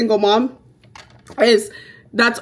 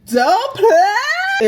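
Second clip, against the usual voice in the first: high, drawn-out hyped cry; over-the-top hyped outburst; playful high squeal; 11 semitones higher than usual. Acted. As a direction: squeal it like a rowdy boast.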